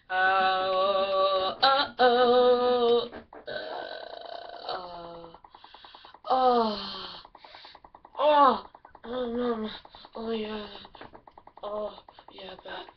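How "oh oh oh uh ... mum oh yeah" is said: hammed-up loud moans, pitch jumping up; mock moaning. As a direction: overdo a mum moaning in the bedroom. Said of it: "your mum just in the bedroom going".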